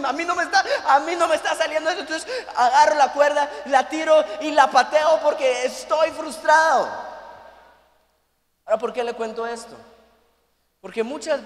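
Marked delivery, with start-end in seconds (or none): 0.1-2.0 frustrated laughing whine. Retold or imitated. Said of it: "Y yo así como". 2.0-6.9 louder, higher frustrated outburst; frustrated shout. Retold or imitated. Retelling kicking the rope.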